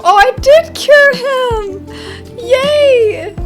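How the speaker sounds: high, loud, drawn-out delight; delighted cheer. Real, not acted. Player learns the patient was cured, real delighted cheer.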